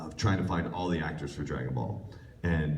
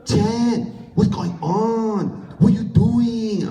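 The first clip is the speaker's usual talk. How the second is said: bad Japanese accent; 8 dB louder, slower than usual. Kid botching his audition, high shouted fake Japanese accent. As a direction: shriek it slowly in a terrible mock-Japanese accent. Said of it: "trying to do a Japanese accent".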